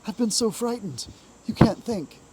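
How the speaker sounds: a touch of fear, voice lifted; frightened cry. A narrator's reading. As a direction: Mole to Rat after his scare, mildly frightened. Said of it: "he cried".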